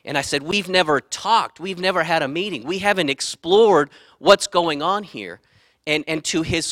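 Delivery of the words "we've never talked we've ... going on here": higher-pitched, indignant reenacted complaint; heated retelling of a yelled complaint; retold yell. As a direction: press each grievance, voice raised. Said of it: "I yelled at him".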